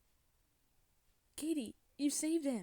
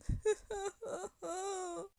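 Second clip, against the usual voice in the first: high-pitched play-acted sobs; pretend sobbing; 8 semitones higher than usual. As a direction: sob in make-believe distress. Upset mermaid breaks down crying.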